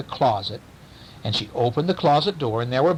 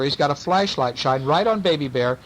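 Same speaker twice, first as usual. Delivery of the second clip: ordinary delivery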